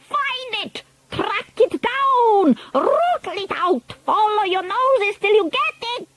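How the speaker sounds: very high, urgent commands; frantic high cries. A narrator's reading. Witches urging each other to hunt the boy, frantic high cries. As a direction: cry out the hunt like a pack.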